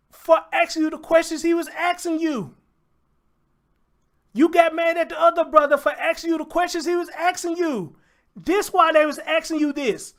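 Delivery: loud, heated call-out; angry yelling. Real, not acted. Reacting to the interview, yelling angrily at him. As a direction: tear into him for how he acted. Confronting a man over an interview.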